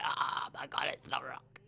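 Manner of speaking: hushed, breathless awe; stunned fan whispering; awed whisper. Retold or imitated. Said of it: "And it's like".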